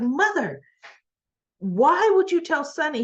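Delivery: high-pitched acted daughterly exasperation; exasperated reproach. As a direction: scold mother, imitating an appalled daughter. Daughter scolding mother for telling secret.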